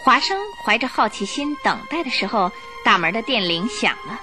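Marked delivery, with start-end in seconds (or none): none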